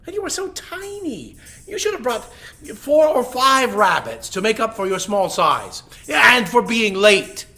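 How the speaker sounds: big loud demanding lion voice; a greedy king of beasts scolding the rabbit; lion king, hungry and boastful. Acted.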